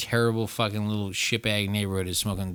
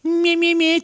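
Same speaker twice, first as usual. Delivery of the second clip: mocking meh; 8 dB louder, slower than usual. Mocking noise.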